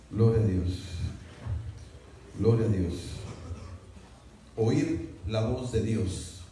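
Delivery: ordinary talk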